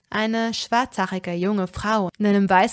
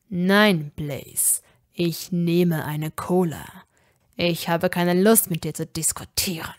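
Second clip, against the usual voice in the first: irritated snap; 5 semitones lower than usual. Acted. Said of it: "fauchte er".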